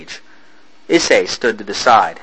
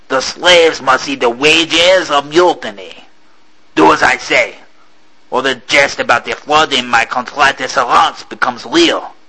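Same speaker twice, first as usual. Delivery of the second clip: captain's threat; 3 semitones higher than usual. Acted.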